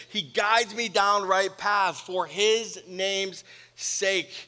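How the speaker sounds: slow, weighty verse read out; slow scripture reading. Shown as a demonstration. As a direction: let each word of the verse land slowly. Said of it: "quoting scripture slowly".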